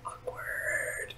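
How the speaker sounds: whispered aside. Real, not acted. Muttered aside.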